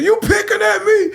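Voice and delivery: high, indignant challenge through laughter; offended. Retold or imitated. Young lady accusing the laughing DJ of mocking her, indignant. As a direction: confront him for mocking you.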